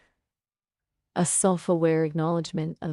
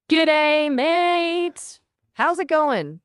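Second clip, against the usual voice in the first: attempted Australian accent; 9 semitones higher, 3 dB louder, slower than usual. Shown as a demonstration. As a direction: parody of an Australian, high-pitched opening. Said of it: "let's try Australian".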